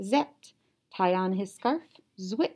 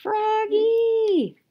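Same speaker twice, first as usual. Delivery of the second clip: long high louder call of Froggy; 14 semitones higher, 7 dB louder than usual. Acted. Mother calling Froggy back from outside, long high call. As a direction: call him from across the yard. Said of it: "called his mother".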